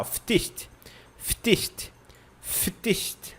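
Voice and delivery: "ftist" on its own, crisp consonant cluster; "ftist" with f, t and st all audible; tricky ending isolated. Shown as a demonstration. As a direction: sound out just the ending "ftist". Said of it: "Esse finalzinho aqui pega".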